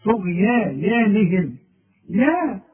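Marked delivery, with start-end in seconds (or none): 0.0-1.6 طغيانهم slowly with a thick ya by the ghayn; correct heavy ya. Shown as a demonstration. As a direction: stretch طغيانهم full and heavy. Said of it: "شوف". (طغيانهم بياء مفخمة وببطء)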